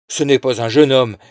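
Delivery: loud furious cry. A narrator's reading. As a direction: shout it in fury. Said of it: "cria Criquet avec fureur".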